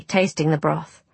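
usual speaking voice

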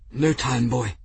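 a hint of a strained, hurt man; wounded monk. A narrator's reading. Injured monk's voice in narrated story.

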